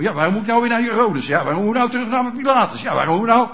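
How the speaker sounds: mock flustered objection; agitated protest. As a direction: protest in a rapid, repetitive whine. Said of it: "in beroering kunnen komen".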